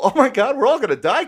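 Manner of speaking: high, loud mock-doomed cry; cynical panic. Fisherman recalls riding monstrous waves, cynical mock panic. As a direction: cry out in hammed-up gallows humor. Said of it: "cynical ... kind of laugh".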